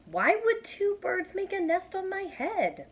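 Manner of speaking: high incredulous question; puzzled Elephant. Acted. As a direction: ask it in disbelief.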